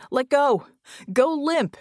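loud, high urgent commands; shouted warning. A narrator's reading. Red yells to falling Vicky, urgent shout. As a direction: yell orders to someone falling. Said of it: "she heard Red shout as she fell".

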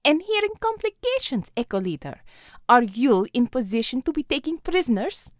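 a Russian commander speaking English; Russian accent. Acted. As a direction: lay on a thick Russian accent. Russian figure Xavier.

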